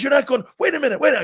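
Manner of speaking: high, loud mock panic; imitating freaked-out manager. General manager trying to stop a player leaving, retold in a high panic. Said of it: "freaked out".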